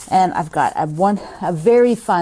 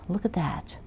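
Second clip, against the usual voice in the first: whisper; 11 dB quieter than usual. Real, not acted. Showing the honey to viewers, whispered. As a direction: look at that whispered with no voice.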